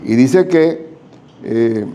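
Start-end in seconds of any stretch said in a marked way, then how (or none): none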